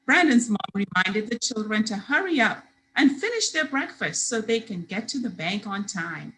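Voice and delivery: usual speaking voice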